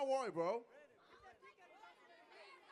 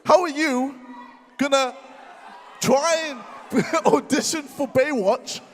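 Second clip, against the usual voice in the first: loud furious roast; angry shouting; 10 dB louder than usual. Real, not acted. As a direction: yell the insults at him. Shouted press conference trash talk.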